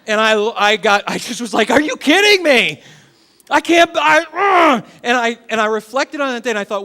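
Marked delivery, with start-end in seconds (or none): 1.7-4.8 exasperated shout, high and loud; losing it. Retold or imitated. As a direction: re-enact a dad blowing up at his kids. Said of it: "and they broke me".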